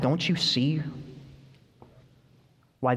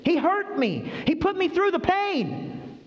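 put-on high, slow hurt child's voice; child complaining to a parent; whining child; slower than usual. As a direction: plead like a wounded little kid.

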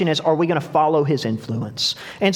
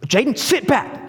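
raised pitch, frustrated retold order; retold frustration; 6 semitones higher than usual. Older brother to back-seat passenger, frustrated. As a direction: act out losing patience with a kid brother. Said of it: "then I'm getting frustrated".

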